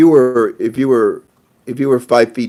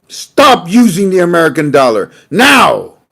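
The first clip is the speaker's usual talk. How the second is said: higher, louder, barked presidential order; commanding shout; 7 semitones higher, 7 dB louder, slower than usual. Retold or imitated. The president ordering the central bank, a higher, louder commanding shout. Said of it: "the president turned to the C B I and said".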